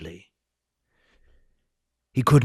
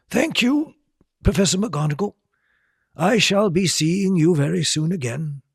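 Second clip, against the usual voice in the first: put-on higher kindly old wizard; lightly voiced gracious headmaster; Dumbledore voice; 10 semitones higher, 3 dB louder than usual. A narrator's reading.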